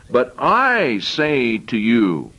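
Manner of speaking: very slow, weighty "I"; emphasized I. Shown as a demonstration. Preacher reading the verse, slow stress on I. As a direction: draw out "But I say to you". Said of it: "now I want to emphasize".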